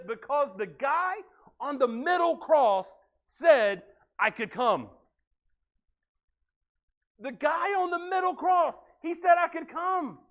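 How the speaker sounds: slow, high, emphatic, leaning on "middle"; climactic answer. Retold or imitated. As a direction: make it the punchline of hope.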